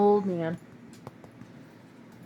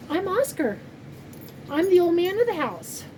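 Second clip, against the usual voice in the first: much higher playful voice speaking for the dog; dog's voice; 9 semitones higher than usual. Pretend. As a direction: give the old dog a silly high voice. Voicing her dog Oscar.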